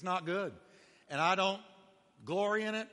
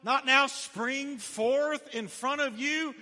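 ringing scripture cry; 7 semitones higher, 4 dB louder, much slower than usual. Acted. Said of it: "here it is".

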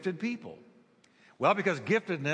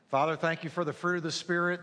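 normal talking voice

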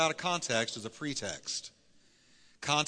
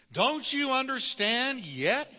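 very high, slow, disbelieving question; exasperated Jesus; 10 semitones higher, much slower than usual. Acted. Jesus asking the disciples, high and slow with disbelief. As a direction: stretch it out in disbelief. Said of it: "he asked them".

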